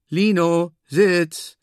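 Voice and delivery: loud, high call to a dog; called command. A narrator's reading. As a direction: call out the order from across the room. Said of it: "ruft Moma in diesem Moment". (lauter, gerufener Befehl)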